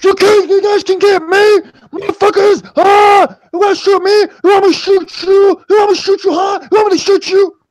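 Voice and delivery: screaming high and loud at them; furious high-pitched shouting; yelling. Acted. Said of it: "And Hank is like".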